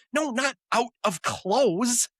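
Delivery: hammed-up alarm; Ross freaking out. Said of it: "Ross freaks out".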